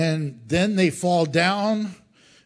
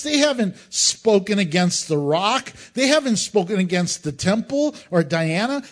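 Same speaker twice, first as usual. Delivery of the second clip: exasperated official; 7 semitones higher, 3 dB louder than usual. Retold or imitated. Clerk's speech paraphrased.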